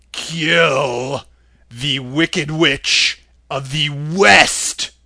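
booming wizard head. A narrator's reading. Oz answering Dorothy's plea, slow and lordly. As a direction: speak slowly like a great magic head.

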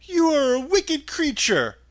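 put-on high-pitched girlish accusation; indignant girl's cry. A narrator's reading. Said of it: "cried Dorothy".

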